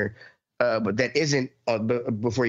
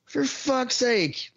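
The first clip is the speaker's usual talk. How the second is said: much higher, very slow disbelieving whine; drawn-out exasperation; 13 semitones higher, much slower than usual. Retold or imitated. Scolding a driver who stops mid-road, high slow exasperated whine. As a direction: stretch it out like you can't believe this driver.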